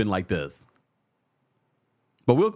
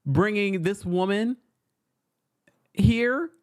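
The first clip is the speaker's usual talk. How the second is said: incredulous; 7 semitones higher than usual. Retold or imitated. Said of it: "And I was like".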